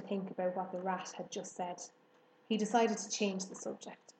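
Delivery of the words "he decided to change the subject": ordinary delivery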